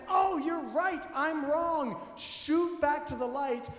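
a bright moment of owning up; joyful repentance. Acted. Husband realising he was wrong, happily repentant. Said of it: "the joy of repentance".

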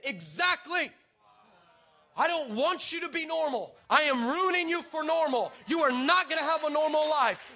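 God's retort, building to a high, loud shout; yelling back. Retold or imitated. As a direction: yell it back as God, acted. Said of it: "And he yelled, yelled back".

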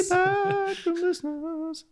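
high squeaky chipmunk voice, slow. Pretend. Squeaky jingle echo.

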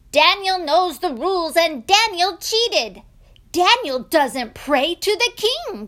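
loud, high tattling voice; accusing princes. Acted. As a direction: denounce him indignantly to the king. Princes accuse Daniel before king.

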